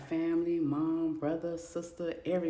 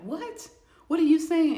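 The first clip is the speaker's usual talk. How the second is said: very high, then loud shocked cry; horrified disbelief; 12 semitones higher, 10 dB louder than usual. Acted. Reacts to his threat.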